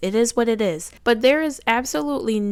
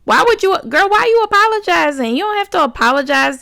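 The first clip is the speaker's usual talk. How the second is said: much higher and louder, pleading disbelief; exasperated outcry; 8 semitones higher, 6 dB louder than usual. Real, not acted. Vlogger urging the daughter not to apologize, exasperated.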